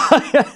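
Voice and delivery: short burst of laughter. Real, not acted. Laughing at a lucky smash.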